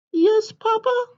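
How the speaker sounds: a tiny excited hen butting in; little chicken voice. Acted. Chicken bursting into papa's story, high and eager.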